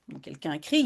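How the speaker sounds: normal talking voice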